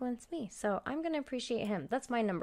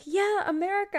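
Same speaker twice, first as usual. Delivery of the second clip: mock cheer; 11 semitones higher, 4 dB louder than usual. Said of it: "I'm sitting here like".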